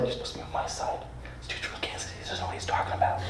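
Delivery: unvoiced conspiratorial whisper to his friend. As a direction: hiss it secretly to your buddy.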